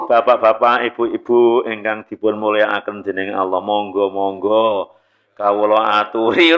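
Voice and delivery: sedikit meniru khotib yang lembut dan pelan; khotib Jawa halus. Retold or imitated. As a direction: tirukan pembuka khotbah Jawa yang pelan. (meniru pembuka khotbah bahasa Jawa, lebih rendah)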